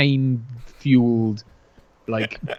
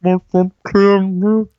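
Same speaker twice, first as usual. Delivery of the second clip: put-on high, slow, louder child voice; mock small boy, pitched up and drawn out; little boy; 8 semitones higher, 5 dB louder, slower than usual. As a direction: echo the kid's line in a stretched high voice. Echoing the little boy scene.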